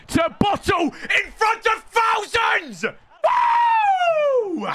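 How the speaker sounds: high, slow gloating yell; high jeering shout; mocking taunt. Real, not acted. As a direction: rub it in his face. Taunting opponent who bottled it.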